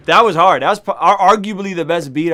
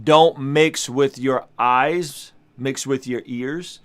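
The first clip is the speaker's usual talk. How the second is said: slow, weighty delivery of his motto; slow catchphrase; 6 semitones lower, 3 dB quieter, much slower than usual. Shown as a demonstration. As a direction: spell it out slowly like a rule to remember. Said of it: "that's when I say".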